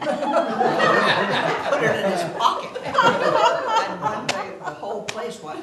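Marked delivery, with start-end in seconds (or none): 0.0-4.5 laughing through the words, slowed; words stretched out by laughter; giggling as she tells it. Real, not acted. Laughing while telling story.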